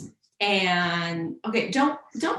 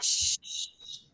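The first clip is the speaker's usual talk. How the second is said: hushing; 7 dB quieter than usual. Pretend. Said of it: "I have to whisper".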